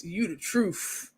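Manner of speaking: slow, soft [name] impression. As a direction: drop into a laid-back [name] voice. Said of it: "Nandy in my [name] voice".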